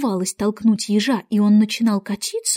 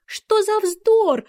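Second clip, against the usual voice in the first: high, drawn-out protest; loud and firm; 11 semitones higher, much slower than usual. A narrator's reading. Alice interrupts the raging Queen, loudly dismissive. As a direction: cut in much higher, stretching it slowly. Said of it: "громко сказала Алиса".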